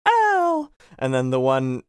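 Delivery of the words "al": very high, drawn-out falsetto call; imitation damsel's call, pitched way up; Jasmine. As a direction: squeal the name in mock-feminine falsetto. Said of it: "in J-, Jasmine impression says".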